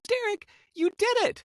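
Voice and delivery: imitated excited falsetto squeal; Renee. Redhead celebrates his move to Woodstock, imitated excitement. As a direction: cheer very high, mimicking her thrill. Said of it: "Why are you so excited?".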